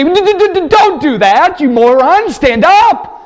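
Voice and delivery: high, stammering, panicky angel scolding; a frantic angel hissing at a blundering John; flustered angel. Acted. Angel scolds John in the throne room, high and flustered. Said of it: "But he said to me".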